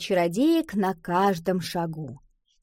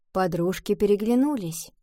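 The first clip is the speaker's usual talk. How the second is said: plain reading voice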